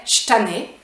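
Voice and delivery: "je tanné" said "ch'tanné"; bare "ch" onto "tanné", no vowel; Quebec contraction. Shown as a demonstration. Teacher repeating the example, contracted pronunciation.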